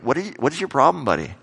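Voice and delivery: fast, high, exasperated challenge; mock-annoyed what is your problem; irritated retort. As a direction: snap back at a stranger who is swearing. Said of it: "I'm looking at him going".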